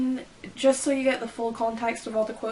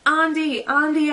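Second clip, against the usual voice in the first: calling a name, louder and higher; read-out yell; 5 semitones higher, 6 dB louder than usual. A narrator's reading. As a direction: call the name out across the beach. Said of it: "I yelled at him".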